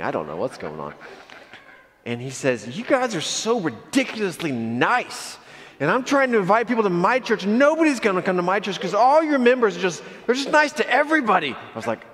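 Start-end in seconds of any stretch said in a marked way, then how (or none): 2.8-11.5 high, loud comic ranting; mock exasperated rant. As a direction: imitate a worked-up complainer, pitched way up. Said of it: "he keeps ranting".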